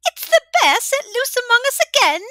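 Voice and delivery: high, loud, cackling tavern woman; boisterous old widow. A narrator's reading. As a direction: hail an old friend across the room. Widow innkeeper greets Bear.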